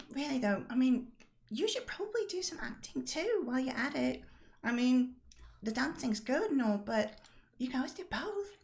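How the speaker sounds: high, chatty game character; Rosalind, encouraging. Acted.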